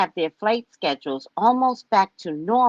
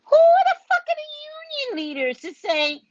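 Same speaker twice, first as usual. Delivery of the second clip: much higher, piercing outrage; outraged shriek. Acted. Vlogger shrieking at union leaders in outrage. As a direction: shriek it in disbelief.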